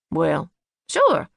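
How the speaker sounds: low, loud drawled agreement; Daddy voice. A narrator's reading. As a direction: answer like a jolly Santa dad. Daddy's cheerful voice.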